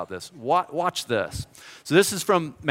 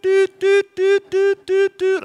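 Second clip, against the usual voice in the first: high, steady beeping doots; dial tone imitation; 6 dB louder, slower than usual. Imitating the dial tone heard on the payphone. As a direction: hum it like a phone tone.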